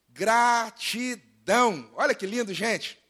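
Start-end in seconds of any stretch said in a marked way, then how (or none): 0.1-1.9 "Gratidão" stretched syllable by syllable; drawn-out emphasis. Real, not acted. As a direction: say "Gratidão" very slowly, each syllable separate. Savours the sermon's key word.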